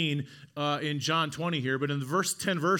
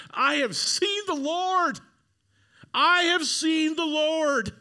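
much higher, louder, slow ecstatic cry; joyful proclamation; 13 semitones higher, 6 dB louder, much slower than usual. Acted. Mary crying out to the world that she saw Jesus. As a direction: shout it out like the best news ever. Mary announces the risen Lord.

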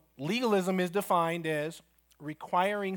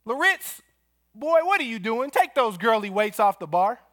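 much higher, louder coach's yell across the gym; 6 semitones higher, 7 dB louder than usual. Retold or imitated. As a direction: bark it across the weight room like a football coach. Coach yelling across weight room.

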